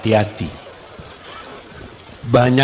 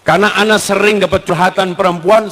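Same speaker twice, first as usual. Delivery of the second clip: ordinary delivery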